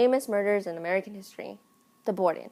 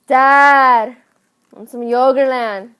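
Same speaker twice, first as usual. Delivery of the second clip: loud, higher, drawn-out call to Dad; demanding shout; 4 semitones higher, 10 dB louder, slower than usual. Retold or imitated. Entitled daughter demands frozen yogurt, loud and demanding. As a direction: holler like an entitled grown child.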